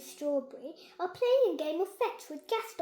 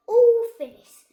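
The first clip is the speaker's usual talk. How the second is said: much louder, higher all finished; triumphant announcement; 8 semitones higher, 19 dB louder than usual. Acted. Ben telling the fairies the robot is done, triumphant. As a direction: announce it proudly to everyone.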